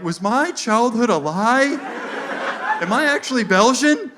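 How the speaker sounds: high, bewildered questioning; hammed-up identity panic; mock existential crisis. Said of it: "Dude is having an existential crisis".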